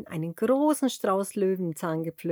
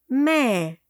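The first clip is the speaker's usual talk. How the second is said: a high, louder bleat like a sheep; 4 semitones higher, 5 dB louder than usual. Shown as a demonstration. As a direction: bleat it out for the kids. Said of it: "Weißt du, wie ein Schaf macht?".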